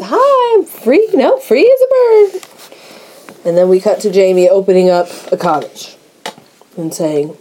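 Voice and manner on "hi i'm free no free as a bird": high, giddy and breathless on the phone; giddy girly voice. Retold or imitated. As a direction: squeal it like a smitten young woman. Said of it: "And she says".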